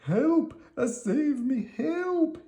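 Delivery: Big Anthony up the tree, yelling for help; crying for help. A narrator's reading. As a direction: scream for rescue from the treetop. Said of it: "he cried".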